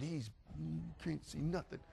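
very soft, near-whispered protest; hushed insistence. Retold or imitated. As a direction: insist secretly, under your breath. Secret warning to friends.

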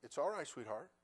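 put-on soft, tender reassurance to a scared child; comforting granddaughter. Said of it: "I said".

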